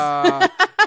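high, loud "ah"; mock cry. Host acts out the reaction to chat, loud high cry. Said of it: "It was just more of like".

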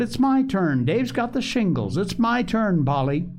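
put-on high-pitched, insistent boy; storyteller's excited little-boy voice; eager cry. A narrator's reading. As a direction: claim it like a child demanding his go. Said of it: "cried Joel, springing forward".

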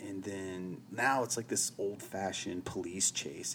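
normal talking voice

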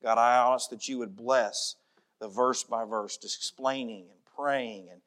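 soft, low prayer voice; hushed pastoral prayer. Acted. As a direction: pray humbly to God. Pastor leads closing prayer.

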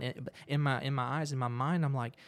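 ordinary talk, nothing marked

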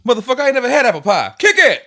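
high fast yelled line; shouting. Pretend. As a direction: belt it out wildly. Hammed-up song shout.

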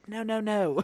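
lightly voiced critical mother; mock disapproving mom. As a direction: imitate a mom scolding her chef son. Imitating his critical mother.

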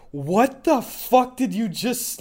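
high, slow incredulous reaction; stunned disbelief. Retold or imitated. Class reacting to a casual slur, stunned disbelief. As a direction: draw out the retold shock.

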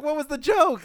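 high, loud, laughing incredulity; exasperated disbelief. Retold or imitated. Clerk baffled by boss's prank, loud laughing disbelief. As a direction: cry out in amused bafflement.

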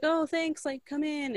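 high, put-on welcoming voice; polite hostess. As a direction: imitate a hostess politely letting a visitor in. Acts out polite welcome at door.

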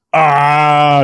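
high loud startled monster yell; Sasquatch yell. Acted. Sasquatch yelling when Bob grabs him, sharp yell. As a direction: yelp out like a beast grabbed. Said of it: "yelled the Sasquatch".

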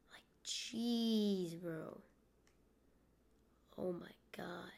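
exasperated whisper. Real, not acted. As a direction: whisper it, fed up. Frustrated by hiccups.

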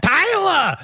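very high-pitched mock holler; imitated dad's yell. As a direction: call out like a dad yelling through the house. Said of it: "used to yell around the house".

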